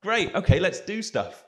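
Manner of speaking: high, eager yes from enthusiastic locals; excited locals. Retold or imitated. Locals eagerly agreeing to make music, high and excited. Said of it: "People go".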